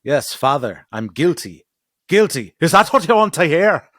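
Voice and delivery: hammed-up courtroom defiance; mock Tyrion defiance. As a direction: spit it back at his father, rising. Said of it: "when Tyrion's on trial".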